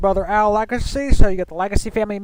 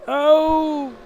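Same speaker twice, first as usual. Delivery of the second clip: surprised oh; 9 semitones higher than usual. Real, not acted. Near-contact on the last lap.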